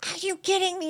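mock outraged father; furious dad. Retold dad's furious disbelief.

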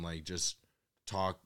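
ordinary talk, nothing marked

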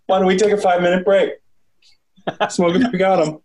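lightly voiced Dark Helmet bossing his troops; Rick Moranis impression. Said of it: "like him in Spaceballs".

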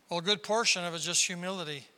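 normal talking voice

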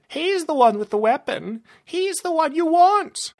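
high, rising to loud; frantic villain cry; desperate pleading. Acted. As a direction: shift the blame in a panic. Villain diverts monster onto Bront.

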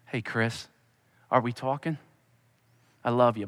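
soft, low, gentle call; tender father. Pretend. God voiced softly calling Chris to talk, tender. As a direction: coax him like a loving dad.

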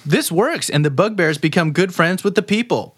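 ordinary delivery

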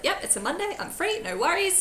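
high, soft, easy-going reply; breezy agreement. Retold or imitated. Teacher agreeing to help a student after school, breezy and high. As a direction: lightly sing out a willing yes, softly.

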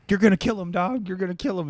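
alarmed. Retold or imitated. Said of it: "He's like".

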